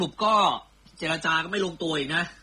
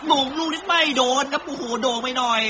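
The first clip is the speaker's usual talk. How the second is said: higher and louder as the cross flies in; excited call; 8 semitones higher, 8 dB louder than usual. Acted. Commentator reacting to a header over Lukaku, excited higher louder call. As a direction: burst out as the header just misses. Near miss on goal.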